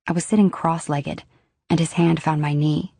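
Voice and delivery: ordinary delivery, nothing marked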